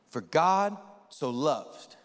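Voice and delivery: each word slowed and weighted; slow deliberate quote. Acted. Preacher quoting Jesus, slow and weighty. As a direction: let it land slowly.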